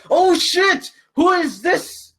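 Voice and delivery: high alarmed shout; startled panic. Acted. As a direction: yell like startled guards. Guards panic at attacker.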